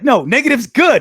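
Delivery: high, mock-exasperated correction; retold protest. Retold sitcom exchange, correcting Michael.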